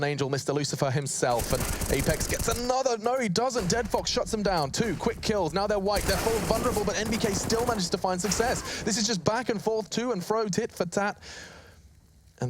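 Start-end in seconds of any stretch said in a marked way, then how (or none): 1.5-11.2 excited. Real, not acted. Caster hype on big play.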